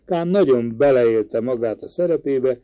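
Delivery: plain reading voice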